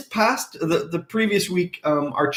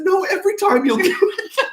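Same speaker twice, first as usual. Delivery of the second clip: high, laughing stern correction; 13 semitones higher than usual. Retold or imitated. Husband retelling his firm reply to the teller, mock stern. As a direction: lay down the law, half laughing. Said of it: "I usually don't speak that way".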